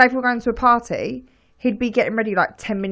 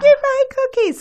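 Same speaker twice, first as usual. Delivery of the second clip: much higher, thrilled squeal; delighted child; 4 dB louder than usual. Retold or imitated. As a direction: squeal with happy surprise. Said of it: "She's like".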